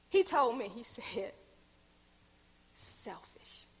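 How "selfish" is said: breathy whisper, barely voiced; whispered word. Preacher whispers God's one-word rebuke. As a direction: whisper it like a secret revelation.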